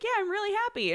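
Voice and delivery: lightly re-enacted happy squeak; reply high-pitched, as if overcome; emotional and happy. Wife answering husband, imitating her own high emotional voice. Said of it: "me being really emotional and happy".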